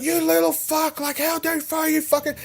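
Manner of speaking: high shrieking mum; angry mum voice. Retold or imitated. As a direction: mimic a screaming mother. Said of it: "she's like".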